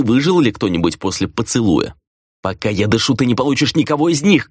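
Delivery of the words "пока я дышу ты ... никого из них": mild growl of refusal; narrator's restrained take on rage; furious growl. A narrator's reading. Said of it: "яростно прорычала Хранья".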